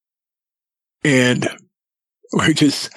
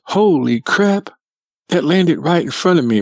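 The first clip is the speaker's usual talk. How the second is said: startled; 7 semitones higher than usual. Retold or imitated. Daughter-in-law as walnut lands in front of her, startled. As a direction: stretch it out high, as if stunned.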